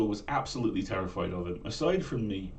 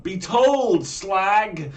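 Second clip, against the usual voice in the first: much higher, loud Manx yell; shouted Manx accent; 9 semitones higher, 10 dB louder, much slower than usual. Retold or imitated. Girl telling her friend off in the street, loud Manx shout. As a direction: snap it at a friend in broad Manx. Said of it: "in that exact tone of voice".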